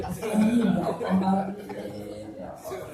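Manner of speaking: slow, drawn-out hammed-up weeping amen; mock tearful amen. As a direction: stretch each amen like a sobbing wife. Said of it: "Nangis lagi". (amin panjang sambil pura-pura menangis)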